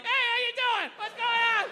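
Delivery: high, loud cheerful hello; dad greeting the priest at mass, overly cheery; breezy greeting. Retold or imitated. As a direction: act like nothing happened. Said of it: "my dad just comes walking like".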